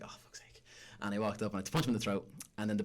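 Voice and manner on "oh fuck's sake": very quiet, voiceless mutter; hushed, exasperated aside; retold exasperation. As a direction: imitate a weary curse under the breath. Retold reaction of the man waited for.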